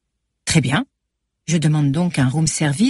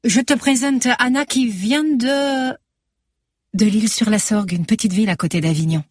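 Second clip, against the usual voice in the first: introducing Anna with a light Marseille lilt, higher; Nabila; 5 semitones higher than usual. A narrator's reading. (présentation légère, beaucoup plus aiguë, à l'accent marseillais)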